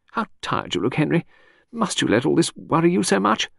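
breezy chatter that floats from topic to topic; Lucy, lady of the house. Acted. Chatting to her husband in the garden, airy high lady.

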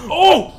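sudden high, very loud "oh!"; startled yell. Real, not acted. Player caught by an attack mid-fight, startled yell. Hit while playing the game.

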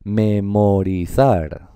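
memorizar very slow, syllable by syllable; sounded out. Shown as a demonstration. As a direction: stretch each syllable of memorizar. Teacher sounds out the word.

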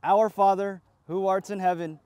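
ordinary talk, nothing marked

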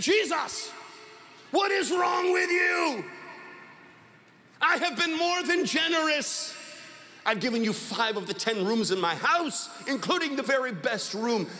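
high, stretched-out angry protest; indignant high-pitched outburst, easing slightly toward the end. Acted. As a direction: rail at Jesus in acted outrage. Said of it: "he got angry".

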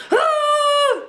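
sudden high squeal of alarm; startled cartoon shriek. Pizza slice squeals as it is picked, high startled shriek.

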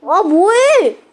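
loud, high annoyed cry; annoyed shout. Real, not acted. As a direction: snap at a teammate. Annoyed outburst.

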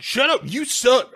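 high-pitched bratty retort; mock indignant outburst. Milo told to apologize to Gribble, mock indignant. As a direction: fire back in a high, affronted voice.

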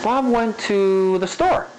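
high and slow, lingering on "to" before "the store"; changed spacing. Shown as a demonstration. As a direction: stretch the middle of the sentence at "to". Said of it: "the way you space it".

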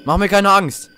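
scared plea. Real, not acted. Scared of the noise.

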